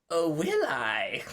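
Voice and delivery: slow, mocking retort; taunting. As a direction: tease him with a drawn-out dare. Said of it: "kind of just taunting a little bit".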